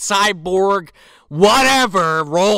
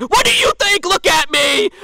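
mock yell; 12 semitones higher, 5 dB louder than usual. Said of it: "And he's like".

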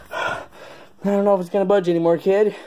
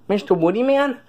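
normal talking voice

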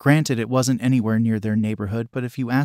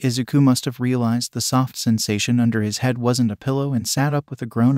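usual speaking voice

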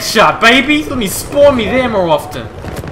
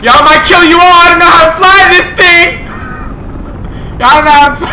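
panicked laughing shout; 9 semitones higher than usual. Real, not acted. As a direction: yell through laughter as you crash. Crashing helicopter, laughing.

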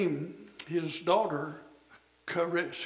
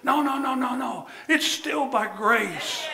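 firm loud rebuke; 6 semitones higher, 6 dB louder than usual. Real, not acted. Rebuking the boastful believer.

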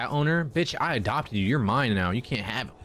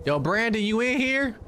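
calling out; 9 semitones higher than usual. Real, not acted. Calling out for Brandon.

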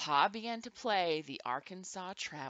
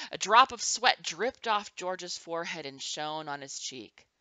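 ordinary delivery

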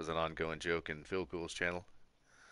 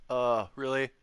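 exasperated surprise; 7 semitones higher, 6 dB louder, slower than usual. Real, not acted. Fish bites at wrong time.

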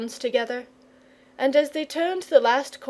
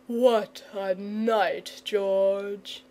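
sleepy murmur; 3 dB quieter, much slower than usual. A narrator's reading. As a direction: mumble it, just waking. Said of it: "he murmured".

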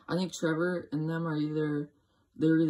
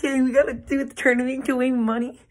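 higher, louder hyped pro gamer; Fortnite pro voice; 7 semitones higher, 6 dB louder than usual. Retold or imitated. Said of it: "like, professional saying that".

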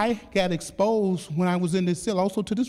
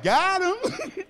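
much higher, laughing out the words; laughing gloat; 11 semitones higher than usual. Retold or imitated. Prisoner gloating after outwitting the warden, laughing high. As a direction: crow with delight through a laugh. Gloating over his win.

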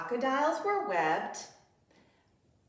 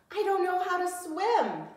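higher, louder worried wail; panicked cub; 11 semitones higher, 5 dB louder than usual. Acted. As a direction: cry out in fear of the water. Cub panics near water.

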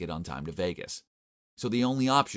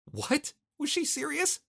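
incredulous; slower than usual. A narrator's reading. Narrator's shocked inner reaction.

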